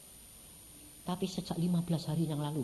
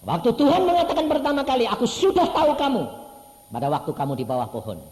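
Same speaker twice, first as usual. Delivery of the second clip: preached quote; 10 semitones higher, 10 dB louder than usual. Retold or imitated. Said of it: "Nathanael berkata".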